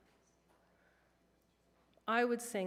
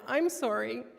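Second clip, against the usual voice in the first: higher, louder, tearful disbelief; retold crying; 4 semitones higher, 7 dB louder than usual. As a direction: relive the tears while asking. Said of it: "really crying when I said this".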